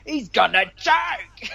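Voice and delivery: high, slow drawn-out Kiwi taunt; Kiwi heckler voice. Retold or imitated. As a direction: bellow it like a fan in the stands. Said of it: "it was a Kiwi ass voice".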